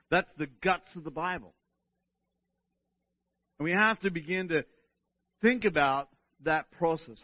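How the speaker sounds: ordinary talk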